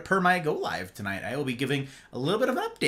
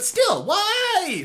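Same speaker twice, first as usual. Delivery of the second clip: high, drawn-out protest; mock anguished why. Streamer still baffled by the audio breakdown, mock anguish. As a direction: wail upward in mock despair. Retold complaint at broken audio.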